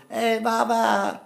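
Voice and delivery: put-on high, drawn-out kid's call; little kids calling. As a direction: call out slowly like a pestering child. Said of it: "And there were little kids".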